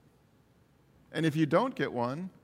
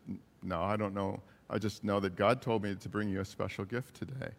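low, soft retold answer; hushed reply; 6 semitones lower, 5 dB quieter than usual. Coworker answering a crying woman, hushed and low. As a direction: answer quietly and low, as if retelling a tender moment.

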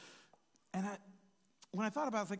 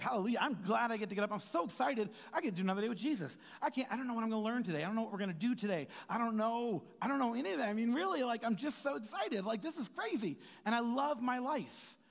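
excited; 4 semitones higher than usual. Retold or imitated. Imagined believer waking up thrilled, fast and gushing. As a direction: bubble over with delight at a new day.

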